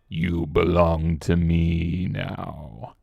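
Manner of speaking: slow, low, soft menace; lightly voiced villain. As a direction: loom over them. Reading Unicron quote.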